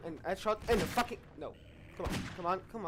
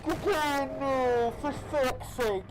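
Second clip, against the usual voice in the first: loud, high wail of protest; drawn-out loud complaint; dismayed yell; 7 semitones higher, 8 dB louder, much slower than usual. Real, not acted. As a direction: yell out in real dismay, falling in pitch. Dismayed outburst at game scare.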